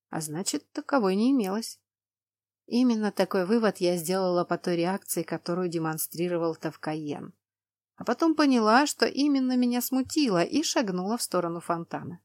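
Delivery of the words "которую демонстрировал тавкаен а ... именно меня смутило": usual speaking voice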